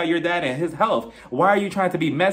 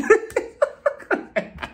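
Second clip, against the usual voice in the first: an airy, silent-wheeze laugh; breathless laugh. Real, not acted. Confessing her mix-up to viewers, breathless laugh. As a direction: laugh almost without voice.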